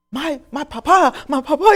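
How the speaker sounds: high, loud, rising cry; a son hearing news of his father; overcome with emotion. Retold or imitated. Harold told his father is found, overcome.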